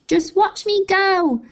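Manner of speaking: high, slow, loud gloating crone; screechy old grandma. A narrator's reading. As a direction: drag it out triumphantly. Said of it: "she called down to George".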